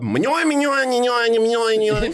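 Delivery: high gibberish chant of a doodle; Doodle Bob gibberish. Retold or imitated. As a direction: chant the nonsense up high like a scribbled cartoon. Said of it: "my homie Doodle Bob".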